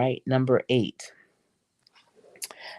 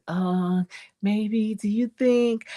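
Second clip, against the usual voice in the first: higher-pitched, hesitant child imitation; timid hemming and hawing; 7 semitones higher, slower than usual. Children shyly asking a parent for a treat, timid and hesitant. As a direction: imitate a shy kid working up to asking. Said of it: "like, umming and ahhing".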